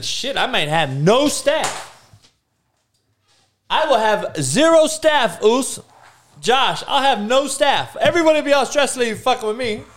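loud, high, fast rant; excited rant. Real, not acted. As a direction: rant in amused disbelief. Excited comic rant, laughing.

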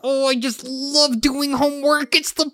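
very high, drawn-out gushing; mock-enthusiastic group voice. Doing the group's shared voice, high hammed-up delight. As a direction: gush it slowly, way up high. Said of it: "collective middle ground where we're just like".

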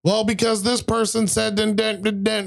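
high-pitched imitation of a kid; retold kid's excuse. Kid answering an annoyed player in Among Us, high mock excuse.

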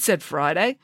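usual speaking voice

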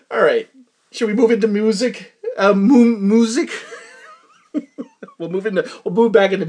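breaking up with laughter; laughing. Real, not acted. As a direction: crack up mid-question. Laughing fit at own joke.

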